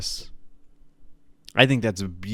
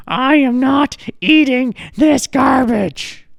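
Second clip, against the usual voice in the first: disgusted granny refusing dinner; 저녁을 거부하는 할머니, 높고 큰 목소리; high, loud granny refusing, slow; 13 semitones higher, 7 dB louder, slower than usual. Retold or imitated. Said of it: "in front of her".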